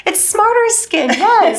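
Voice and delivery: high, slow, squealed echo; playful squeal. Pretend. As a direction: chime in with a bright, drawn-out squeal.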